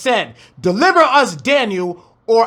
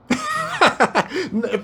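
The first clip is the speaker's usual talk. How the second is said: high laugh; 8 semitones higher, 4 dB quieter than usual. Real, not acted. Laughing at the retold taunt.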